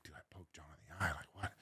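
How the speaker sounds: whispered, quick confession; very soft, low whisper; hushed confiding. Retold or imitated. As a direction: whisper it to a colleague, aghast. Confiding the mishap to a colleague.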